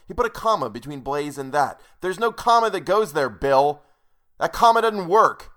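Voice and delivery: loud, high-pitched scolding over a comma; exasperated yelling. Real, not acted. Reader scolding the author Bill, loud angry rant. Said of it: "screaming about semicolons".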